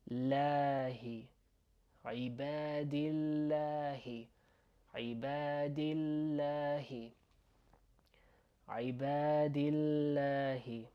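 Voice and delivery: "lahi" with a thin, open long a; slow "ibadillahi", light l and stretched "laa"; light "a" sound. Shown as a demonstration. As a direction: keep "ibadillahi" light, vowels held. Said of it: "a, okay?".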